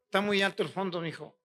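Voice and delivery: dropped, off-mic aside to the tech; quick hushed word to the sound man; quiet aside. Real, not acted. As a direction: lean away and murmur the note.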